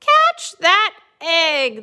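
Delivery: drawn-out acted group shout; storybook yell. A narrator's reading. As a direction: yell each word out to Pig. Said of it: "they yelled to Pig".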